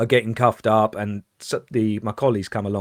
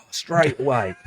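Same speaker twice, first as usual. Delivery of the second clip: normal talking voice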